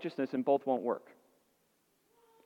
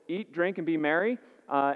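ordinary delivery

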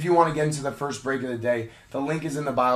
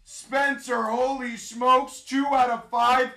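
excited, ringing card-pull announcement; hype announcer; 10 semitones higher, slower than usual. Real, not acted. Streamer announcing Spencer's rookie booklet, hyped call. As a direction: call it out for the winner, amazed.

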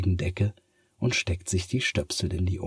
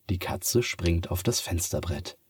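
plain reading voice

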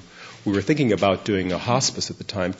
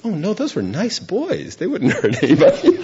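amused reassurance; 11 semitones higher, 4 dB louder than usual. Retold or imitated. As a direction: brush off the worry with a chuckle. Friend gently dismissing danger.

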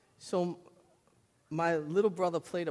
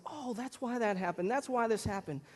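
realizing; 7 semitones higher than usual. Retold or imitated. Brother realizing, voiced high.

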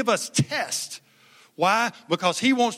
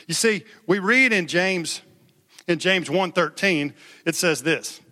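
ordinary delivery

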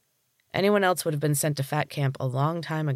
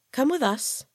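high voice; 11 semitones higher than usual. Acted. Stern dietitian summons Thor.

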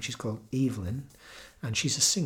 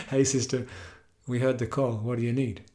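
put-on louder, hearty phone-call quote in own accent; Maori brother; 4 dB louder than usual. Maori brother phoning his sister in Ireland, lightly voiced. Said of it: "a call from one of her Maori brothers".

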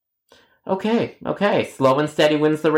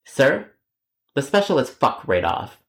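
ordinary talk

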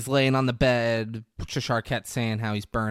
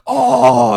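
high, loud cry of mock pain; big imitated pained "oh"; loud mock groan; 14 semitones higher, 17 dB louder than usual. Retelling a man falling out of bed, mock groan.

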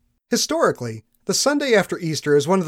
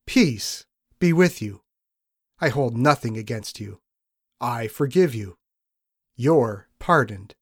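slow, lower and tender, each phrase unhurried; gentle forgiveness; 4 semitones lower than usual. Retold or imitated. Jesus granting his frightened disciples pardon, tender and slow. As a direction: let every word land as full forgiveness. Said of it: "he grants them pardon without a doubt".

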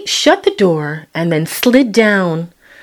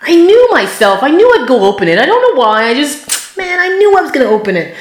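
higher, louder, quick rush with a laugh; excited; 9 semitones higher, 3 dB louder, faster than usual. Real, not acted. Admitting to the viewer she'd open the door, high and laughing. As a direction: race through it, half laughing. Laughing at her own certainty.

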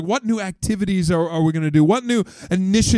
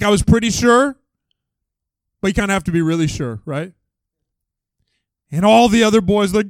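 ordinary delivery

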